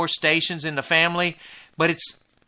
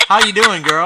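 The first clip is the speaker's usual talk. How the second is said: excited greeting; 4 semitones higher, 8 dB louder than usual. Real, not acted. Greeting the caller warmly.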